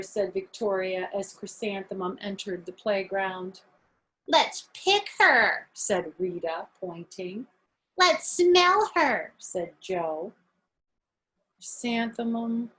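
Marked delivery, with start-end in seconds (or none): 4.3-5.7 slow, high, loud taunt; teasing child. Acted. Rita taunting Chrysanthemum on the playground, slow and high. 8.0-9.3 slow, high, loud taunt; teasing child. Acted. Joe taunting Chrysanthemum on the playground, slow and high.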